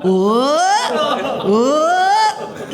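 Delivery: long loud ooh, second one rising high; drawn-out ooh. Acted. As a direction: stretch out a loud, climbing ooh. Comic noise for the nipple visual.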